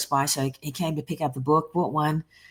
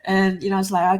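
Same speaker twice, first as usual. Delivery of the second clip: normal talking voice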